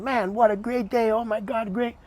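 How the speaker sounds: high, bright delighted exclaim; elated. Retold or imitated. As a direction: gush about a great day. Retold cop after ceremony, pitched up.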